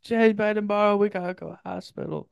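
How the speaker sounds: high, pitiful imitation of a patient; hammed-up sick-bed whimper; mock sickly plea. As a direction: plead weakly in falsetto. Retelling himself sick and desperate.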